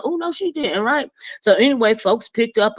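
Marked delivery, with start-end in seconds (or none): 0.0-0.8 shocked sass. Pretend. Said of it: "So I was like".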